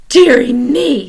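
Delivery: slow, shocked "Dearie me". Acted. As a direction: draw it out in dismay. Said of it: "Missus Popper in a shocked tone".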